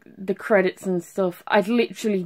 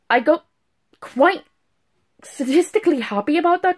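excited; 8 semitones higher than usual. Real, not acted. Excited about the plot.

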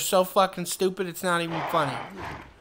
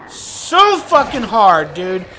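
whiny frustration; 6 semitones higher, 9 dB louder, slower than usual. Real, not acted.

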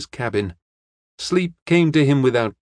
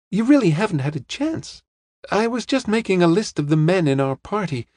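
put-on higher-pitched young woman explaining her worries; young woman, earnest; 6 semitones higher than usual. Young woman lays out suspects to detective, high and earnest. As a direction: keep the voice up high as she sets out the case.

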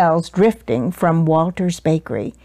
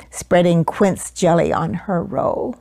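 ordinary delivery, nothing marked

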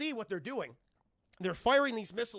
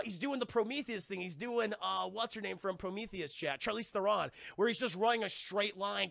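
normal talking voice

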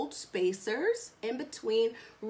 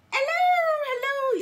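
surprised; 7 dB louder than usual. Real, not acted. Greeting buyers, shocked.

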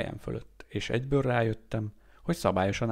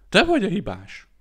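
much higher, loud blaming scream; shrill furious accusation; howled accusation; 9 semitones higher, 9 dB louder than usual. A narrator's reading. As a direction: scream the blame at him. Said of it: "üvöltötte".

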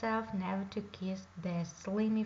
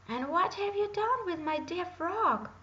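alarmed princess; 8 semitones higher, 4 dB louder than usual. A narrator's reading. Princess demands her frog.

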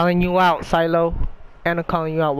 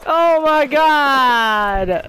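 high, loud, very drawn-out exclamation; shocked outcry; 7 semitones higher, 6 dB louder, much slower than usual. Real, not acted. Player reeling from a game mishap, shocked outcry. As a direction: cry out slowly in disbelief.

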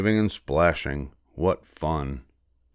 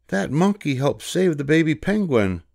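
put-on higher, young boy's voice; boy in the crowd; 9 semitones higher than usual. A narrator's reading. Boy in crowd praises monkey, high young voice. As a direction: play an excited boy in the crowd. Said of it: "said a boy in the crowd".

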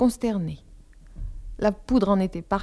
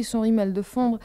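ordinary delivery

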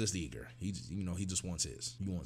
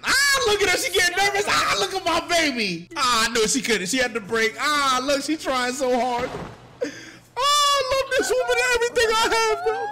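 high, loud, wailing adoration; near-tears gushing; 13 semitones higher, 8 dB louder than usual. Real, not acted. Viewer moved by his favourite queen, emotional wailing. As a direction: cry it out like an overwhelmed fan. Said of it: "I'm about to start crying".

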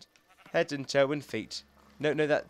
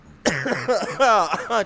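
chesty cough; 5 semitones higher, 7 dB louder than usual. Real, not acted. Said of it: "Chesty cough".

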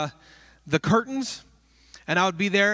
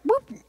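mock vanishing boop; 4 dB louder than usual. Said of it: "and they're like".

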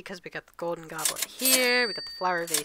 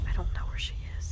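whispering while hiding; much faster than usual. Real, not acted. Hiding from homeowner.

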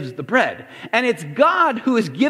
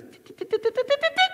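high, soft imitated dial clicks tit tit tit; thermostat clicking noise; 4 dB quieter, faster than usual. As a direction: mimic turning up a thermostat. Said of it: "taking the thermostat and he goes".

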